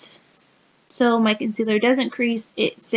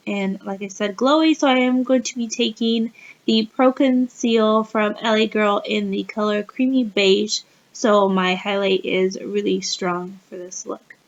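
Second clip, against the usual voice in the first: ordinary talk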